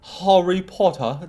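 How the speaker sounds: lightly mocking British pronunciation, questioning; British accent. Shown as a demonstration. Streamer asks chat if they should say it this way, mock British accent. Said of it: "That's just the accent".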